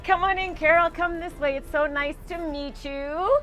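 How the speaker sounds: high, loud gushing greeting; excited welcome. Acted. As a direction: throw the door open and gush over her. Psychic greets guest with fanfare.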